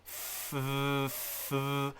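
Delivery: two long F sounds, no voice; breath-only fff, twice, softly; drawn-out soft F noise; voiceless F. Shown as a demonstration. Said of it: "except you take out the voice".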